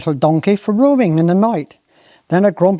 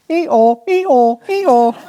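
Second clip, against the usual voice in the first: donkey bray; 6 semitones higher, much slower than usual. Retold or imitated. Storyteller brays like the donkey.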